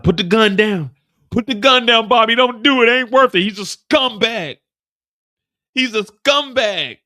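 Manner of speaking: high, loud pleading shout; urgent shouting. Acted. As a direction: yell at him to stand down. Paul shouting at Bobby.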